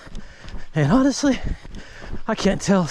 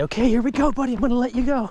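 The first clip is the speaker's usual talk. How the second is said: higher, teasing build-up before the release; dad gleefully warning his son before releasing the stroller, higher; playful dad to son; 9 semitones higher, faster than usual. Retold or imitated. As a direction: rev up the little boy before letting go. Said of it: "I'd say".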